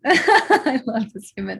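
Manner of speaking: real laugh into the words. Real, not acted. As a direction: say it through an excited laugh. Excited host laughs while introducing friend.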